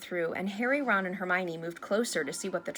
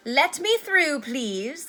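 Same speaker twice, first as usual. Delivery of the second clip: higher, loud officious call; Percy, self-important; 6 semitones higher, 7 dB louder, much slower than usual. A narrator's reading. Head boy bustling through the crowd, self-important. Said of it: "bustling importantly through the crowd".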